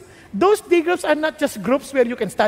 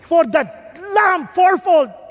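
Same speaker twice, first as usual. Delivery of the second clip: loud, slow, stern pronouncement; slow, loud judge's verdict; 5 semitones higher, 5 dB louder, slower than usual. Retold or imitated. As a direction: hand down the sentence slowly. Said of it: "Sabi niya".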